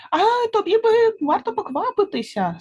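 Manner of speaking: very high little fly; warning fly. A narrator's reading. Fly tells chipmunk to hurry, high-pitched. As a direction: urge in a high pipe.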